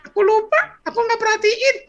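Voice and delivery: put-on high squeaky child voice; loud, high boyish confession; forgetful little boy. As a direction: pipe up like a clueless kid. Boy admits he forgot.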